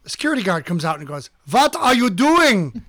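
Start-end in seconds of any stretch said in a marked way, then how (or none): 1.5-2.8 put-on slow, raised German-accented challenge. Security guard confronting the geocachers, imitated German accent. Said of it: "He was very German".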